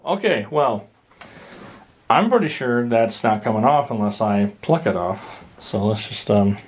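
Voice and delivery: usual speaking voice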